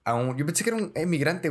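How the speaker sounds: usual speaking voice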